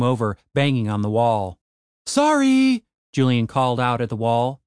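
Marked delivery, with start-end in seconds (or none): 2.0-2.8 calling out. Acted. Calling out apology through wall.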